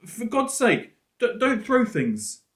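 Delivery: put-on high, pleading girl, stumbling on the d-; a scared girl begging, slowing down; girl's voice. Girl in the bushes begs Fred, high and shaken. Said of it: "It was a girl's voice".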